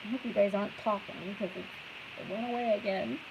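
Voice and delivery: muffled through an unplugged mic. Real, not acted. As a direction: sound distant and muffled, like through water. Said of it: "Back to being muffled and waterfally".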